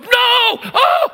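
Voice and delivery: high, loud startled yelp of pain; a retold scream of shock and fear; panicked scream. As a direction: yelp like the needle just hit. EMT jabbed with EpiPen screams.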